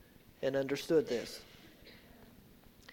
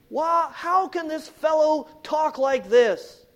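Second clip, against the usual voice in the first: louder, higher, indignant question; forceful rhetorical question; 10 semitones higher, 11 dB louder than usual. Acted. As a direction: throw the question at the crowd. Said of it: "repeated question with force".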